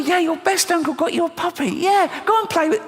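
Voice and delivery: squeaky high sing-song; high mock child voice. Uncle answering his niece in high squeaky baby voice. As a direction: talk down to the kid in her own squeak.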